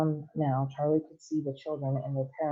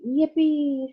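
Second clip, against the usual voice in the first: high, loud whoop of glee; joyful shout; 11 semitones higher, 5 dB louder than usual. Acted. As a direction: whoop like a thrilled old man. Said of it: "shouted Grandpa Joe again".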